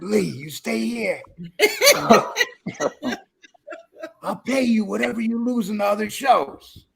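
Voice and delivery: a hint of a put-on sugary Angel voice, with a laugh in it; lightly mock her sweet, generous tone, laughing through it; Angel's fake sweet voice. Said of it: "this is just a fake voice".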